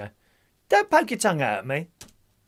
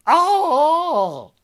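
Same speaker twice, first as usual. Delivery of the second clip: mock Chinese exclamation; 12 semitones higher, 7 dB louder than usual. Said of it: "trying a bit of Chinese".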